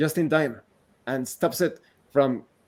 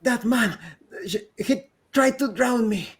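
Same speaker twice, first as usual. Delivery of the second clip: put-on frightened girl; 8 semitones higher than usual. Girl accuses the man.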